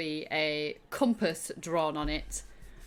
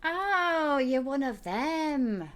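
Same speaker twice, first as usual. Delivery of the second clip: high, drawn-out character voice; high-pitched, slow "now I get it" delivery; dawning recognition; 10 semitones higher, 3 dB louder, much slower than usual. Acted. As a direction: stretch it out in a raised pitch. Game master voices Shrilt's realisation.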